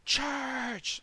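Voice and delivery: high, drawn-out shout; screamed battle command. Acted. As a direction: call the charge to the rowers. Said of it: "he screams to me in Moorish".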